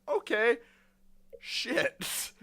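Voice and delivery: high, uneasy mock acceptance; mock dread. Student hearing who takes over the lesson, mock dread. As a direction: act out nervously agreeing, then cursing. Retold reaction to new teacher.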